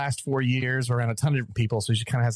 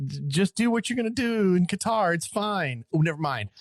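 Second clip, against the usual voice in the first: higher-pitched careless go-ahead, easing down; sing-song "it's all fine" act; mock breezy reassurance; 6 semitones higher than usual. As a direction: imitate officials airily changing their minds. Mocks organisers changing their minds.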